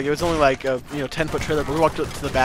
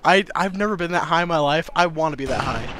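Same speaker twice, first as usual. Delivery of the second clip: usual speaking voice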